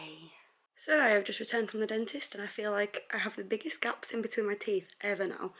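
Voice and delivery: normal talking voice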